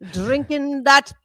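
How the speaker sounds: slow mock Irish lilt; lightly put-on Irish accent, singing the tale. Friend spinning a drinking tale in a light Irish accent. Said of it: "trying to sound Irish".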